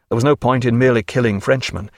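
plain reading voice

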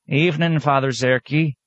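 put-on high old country woman's drawl; high-pitched old peasant woman, drawling. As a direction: drawl it out like a sly old tomato seller. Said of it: "she drawled".

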